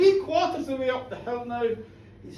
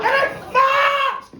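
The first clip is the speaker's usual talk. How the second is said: much higher, louder, slow yell; storyteller roaring the fart joke; gleeful shout; 12 semitones higher, 6 dB louder, slower than usual. A narrator's reading. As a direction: bellow the punchline. Dead cow farts, big shout.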